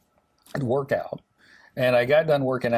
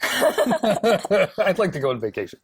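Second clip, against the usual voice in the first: laughing wish; 7 semitones higher, slower than usual. Real, not acted. Laughing wishful joke.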